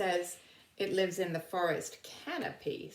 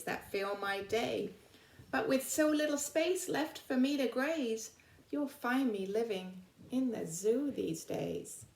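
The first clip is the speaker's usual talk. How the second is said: lively storybook poem reading; reading a poem aloud; 3 semitones higher than usual. Acted. Teacher reading an animal's poem to her class, lively storybook voice. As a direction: read it to the children like a rhyme.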